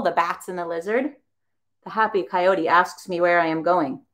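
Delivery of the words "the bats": usual speaking voice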